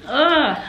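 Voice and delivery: grossed-out groan; disgusted ugh. Real, not acted. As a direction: recoil from a bitter taste. Bitter Marmite taste.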